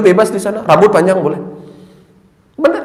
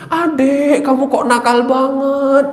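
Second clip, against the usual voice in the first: lightly voiced parent, higher and slow; a hint of a mother gently scolding her child; 9 semitones higher, slower than usual. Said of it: "orang tuanya bilang gini".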